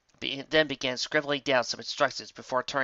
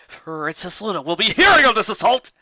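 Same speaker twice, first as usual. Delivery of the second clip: high, loud haughty threat; in no uncertain terms; 8 semitones higher, 9 dB louder than usual. Acted. Glitter Squall threatening Trixie, loud and high. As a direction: threaten with full indignation. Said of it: "Glitter Squall said, in no uncertain terms".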